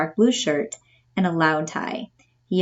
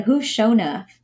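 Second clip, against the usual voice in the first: puzzled Southern lady asking who Sho nuff is; mild Southern accent, confused; imitating a puzzled Southern woman; 4 semitones higher, 3 dB louder, slower than usual. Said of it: "learn the dialect".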